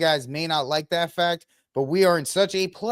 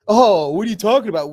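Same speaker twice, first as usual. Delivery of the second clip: mocking dismissal; 3 semitones higher, 7 dB louder, faster than usual. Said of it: "you said".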